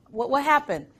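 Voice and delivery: usual speaking voice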